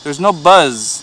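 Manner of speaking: ordinary talk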